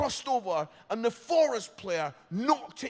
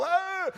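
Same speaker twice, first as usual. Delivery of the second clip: high mock sob of emotion; a big tearful high "Ah!"; hammed-up weepy cry; crowd sob; 12 semitones higher, 3 dB louder than usual. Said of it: "They're all".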